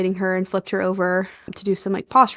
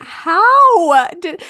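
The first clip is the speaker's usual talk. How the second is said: much higher, louder, almost crying out; shocked exclaim; 9 semitones higher, 8 dB louder than usual. Retold or imitated. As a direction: cry out in disbelief. Shocked at baby's size.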